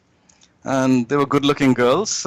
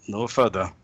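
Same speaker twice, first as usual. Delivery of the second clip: ordinary talk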